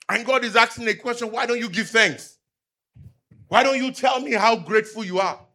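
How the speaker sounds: fervent sermon delivery; preaching. Acted. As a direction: proclaim it to the congregation. Preaching on complaining to God.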